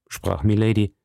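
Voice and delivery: plain reading voice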